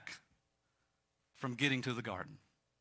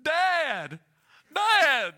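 high, booming yell; yelling across a distance; 12 semitones higher, 11 dB louder than usual. Retold or imitated. Big friend yelling across the airport to him, loud yell. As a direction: holler it across a crowded airport. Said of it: "he starts yelling across the airport".

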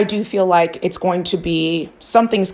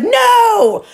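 high, louder, drawn-out "no" retold; mock panicked cry; 5 dB louder than usual. Shocked at getting hacked, mock panicked cry. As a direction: cry out in exaggerated dismay. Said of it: "I was like".

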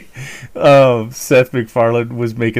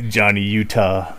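normal talking voice

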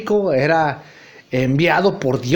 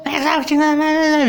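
high, slow scornful insult; storming off; 9 semitones higher than usual. Acted. Nacho walks off insulting his friend, high and slow. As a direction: drag out the insult high as he leaves.

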